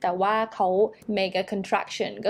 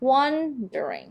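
"wondering" from a stressed WON down through reduced syllables; falling after stress; 4 semitones higher, much slower than usual. Shown as a demonstration. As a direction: let "wondering" drop away after WON. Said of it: "มันจะลงแบบนี้".

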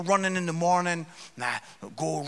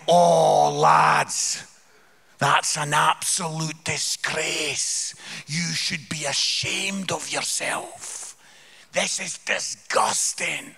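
very slow Scottish; 5 dB louder, much slower than usual. Acted. Scot shaming ten English lads for littering, very slow scolding. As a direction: tell them off slowly and deliberately in Scottish. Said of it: "very slowly in Scottish".

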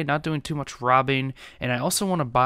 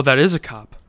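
normal talking voice

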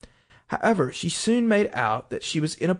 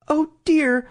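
high, louder cry of dismay; sorrowful; 12 semitones higher, 6 dB louder than usual. A narrator's reading. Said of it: "cried Alice in a sorrowful tone".

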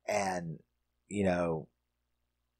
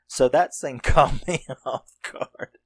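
slow, louder, laughing through the words; 5 semitones higher, 5 dB louder, slower than usual. Real, not acted. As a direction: laugh as you admit the shock. Laughing about the shock.

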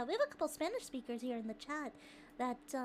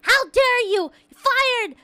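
hammed-up furious shout, much louder and higher; mock outrage; 8 semitones higher, 18 dB louder, much slower than usual. Streamer mock-firing a chatter over the soda joke, hammed-up shouting. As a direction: yell it like a fake boss firing someone.

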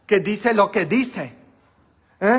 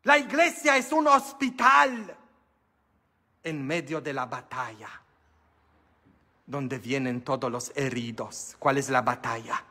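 normal talking voice

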